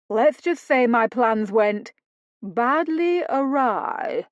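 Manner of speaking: badly awry slow, drawn out in mock upper-class English accent; mocking her posh accent. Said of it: "in an accent she assumed was meant to be hers".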